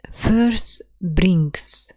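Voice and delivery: both "Verse" and "brings" hit loud; spondee stress. Shown as a demonstration. Teacher shows a spondee to the class, both words stressed loud. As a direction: give "Verse" and "brings" equal heavy weight. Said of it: "Stressed, stressed".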